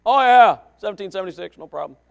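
loud, high, breezy burst; mock teenage eagerness; cocky teenager. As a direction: pipe up like a know-it-all teen. Voices cocky teenager with phone.

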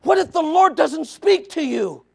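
high, loud pulpit appeal; preacher pleading at full voice; full-force preaching. Real, not acted. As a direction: press the question on the congregation, loud and high.

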